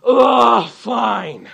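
loud exasperated "Ugh," then a grudging "fine"; a kid groaning her way through a chore; bratty sulky groan. Retold or imitated. Pastor mimicking his daughter's loud grudging reply to a chore. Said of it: "I don't want her to go".

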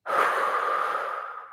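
long unvoiced sigh blowing out; relaxing exhale demo; long breath out. Shown as a demonstration. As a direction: blow the breath out slowly as long as you can. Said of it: "blowing out that breath for as long as you can".

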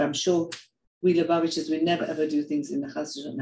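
ordinary delivery

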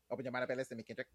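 lightly voiced alien rapper voice; a hint of an otherworldly rap voice. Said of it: "the alien voice thing".

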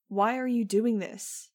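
slow, loud shout; shouted at top of voice. A narrator's reading. Max shouting at Jeb, slow and loud. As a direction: hurl the question at him. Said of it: "I shouted at the top of my voice".

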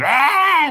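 loud high goat bleat "Ah!"; goat scream. Pretend. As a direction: shriek like a goat. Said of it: "Já vamos botar ele".

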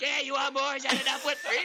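high, loud tipsy granny voice; hammed-up drunk old lady spraying whipped cream; drunk grandma voice. Grandma spraying whipped cream at the kids, high tipsy voice.

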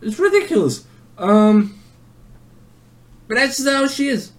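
very high mimicking voice; mimicking fast talker. Storyteller shows how the classmate is, high hammed-up voice. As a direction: keep the classmate's high-pitched impression going. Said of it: "that's too slow".

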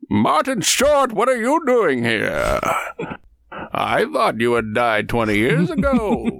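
high, drawn-out silly villain voice; theatrical mock astonishment, stretched slow; swooping parody of a movie villain; Bane. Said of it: "here is, uh, him in the third one".

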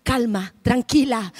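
calm her down gently; preacher soothing a frightened passenger; soothing reassurance. Retold or imitated. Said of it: "Y yo le digo".

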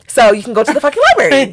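emphatic retort with a laugh in it; retold exasperation. Telling roommate off about studying, exasperated.

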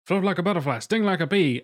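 lightly voiced boxer quote; imitating Muhammad Ali. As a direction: quote the boxer's line his way. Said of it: "Muhammad Ali".

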